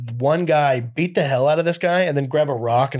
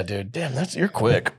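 ordinary delivery

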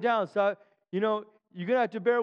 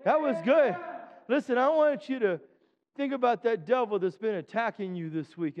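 ordinary delivery

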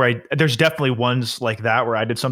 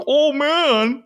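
much higher, teasing groan; mock friend; 13 semitones higher than usual. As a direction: play the smug friend at brunch. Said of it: "be like".

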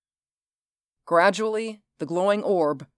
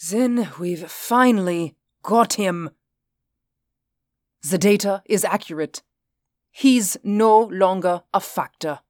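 put-on higher, accented character voice; measured foreign-accented official, relieved; relieved Reinhardt; 4 semitones higher, 3 dB louder than usual. A narrator's reading.

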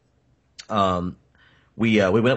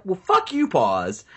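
high angry snap at a friend; pitched-up fed-up curse; mad outburst; 9 semitones higher, slower than usual. Retold or imitated. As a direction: tell him off, much higher. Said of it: "I was fucking mad".

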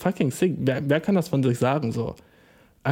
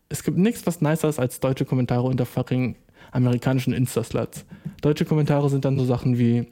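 normal talking voice

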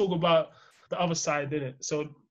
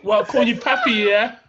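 hammed-up Spanish lover voice, laughing; mock Spanish accent; 2 semitones higher, 6 dB louder than usual. Mocking his friend's Spanish pillow talk in a laughing accent. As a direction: tease him in a put-on Spanish accent. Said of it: "We're putting on full accent as well".

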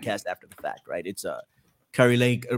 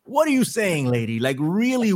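incredulous; 6 semitones higher than usual. Real, not acted. Incredulous at Kari Lake's threat.

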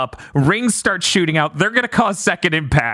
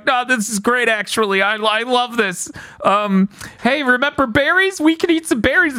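mock crying cheer; 5 semitones higher than usual. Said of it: "crying and just going".

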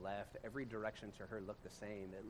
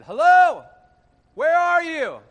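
loud, much higher shout into the woods; calling out loud; 28 dB louder, much slower than usual. Retold or imitated. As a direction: call out to find someone far off. Calling out to lost runner.

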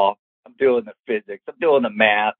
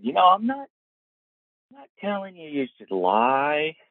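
trying to be nice; 7 semitones higher, 5 dB quieter than usual. Retold or imitated. Driver dropping the kid off, carefully nice about a lie. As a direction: ease into it gently, dragging it out. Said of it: "trying to be nice about it".

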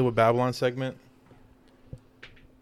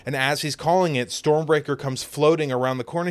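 usual speaking voice

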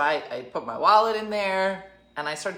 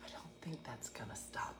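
breathless hushed whisper; 4 semitones lower, 20 dB quieter than usual. Retold or imitated. Scared tourist answering the guide in a whisper. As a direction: whisper it, still frightened.

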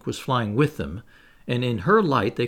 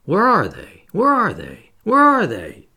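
loud, high echoing shouts; fierce ringing call, rising in pitch; savage cry; 7 semitones higher, 7 dB louder than usual. A narrator's reading. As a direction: cry it out savagely, climbing higher each time. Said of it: "echoes seemed to cry savagely".

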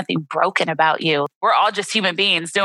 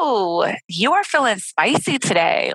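playful best friend; 3 semitones higher than usual. Shown as a demonstration. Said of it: "the way I kinda do it, I'm just like".

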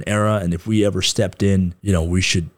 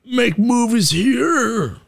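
very high, very slow incredulous mom voice; imitating mom; much slower than usual. Mom scoffs at son making movies, high and slow. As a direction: stretch it out in a high scandalised mother's voice. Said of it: "my mom was like".

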